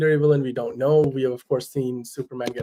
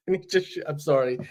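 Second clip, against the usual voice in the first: ordinary delivery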